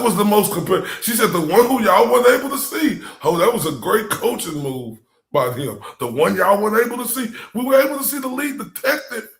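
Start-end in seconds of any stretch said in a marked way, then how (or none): 1.3-3.0 high, loud punch on "weren't able"; loud, raised, exaggerated stress on "weren't"; mock extra emphasis. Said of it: "Look at the extra emphasis". 6.0-9.3 fast, raised mimicry stressing "weren't able"; quick, exaggerated echo with "lead detective" pushed high; mock extra emphasis. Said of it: "Look at the extra emphasis".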